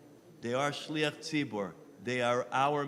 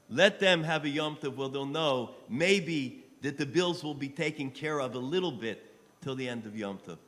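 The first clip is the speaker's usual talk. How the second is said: usual speaking voice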